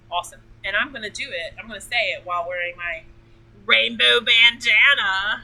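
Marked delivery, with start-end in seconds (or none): 3.7-5.4 loud, drawn-out showy delivery; gleeful announcement. Real, not acted. Showing off the bandana to viewers, gleefully proclaimed. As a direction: belt it out slowly with relish.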